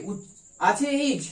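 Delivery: ordinary delivery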